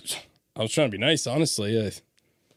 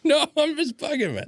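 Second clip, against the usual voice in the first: mock high imitation. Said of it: "you're like".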